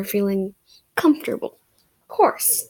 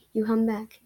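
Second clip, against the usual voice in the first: plain reading voice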